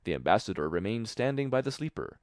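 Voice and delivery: plain reading voice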